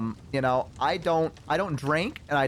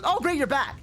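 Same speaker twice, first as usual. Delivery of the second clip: high-pitched imitated delight; eager greeting; 10 semitones higher than usual. Date waiting at restroom door greets him, eager greeting. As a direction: pounce with an overeager welcome.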